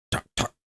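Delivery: soft mouth knocking; gavel knocks. Shown as a demonstration. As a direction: tap out a gavel.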